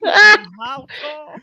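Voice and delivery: high, loud, drawn-out incredulous question; loud disbelief. Real, not acted. Guest protesting his exaggerated height, loudly incredulous. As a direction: blurt it out high and slow in disbelief.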